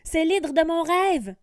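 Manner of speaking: high, loud startled cry; exclaiming. Acted. Diego recognizing the hydra from his dream, loud exclamation. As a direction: gasp it out in recognition. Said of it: "s'exclame Diego".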